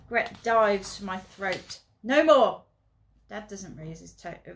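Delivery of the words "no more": loud sharp stern order; stern command. Acted. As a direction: stop them dead with one command. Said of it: "his tone stops us dead".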